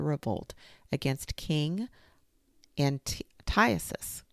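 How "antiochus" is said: ordinary delivery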